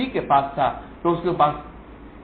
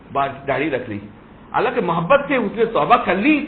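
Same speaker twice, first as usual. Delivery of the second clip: loud, high impassioned sermon delivery; fiery preaching; 4 semitones higher, 7 dB louder than usual. Acted. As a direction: drive the story home to the congregation.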